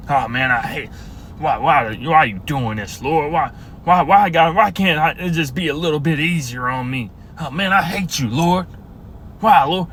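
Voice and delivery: bitter complaint to the Lord; resentful grumbler. Acted. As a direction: grumble it, then whine. Acting resentful believer.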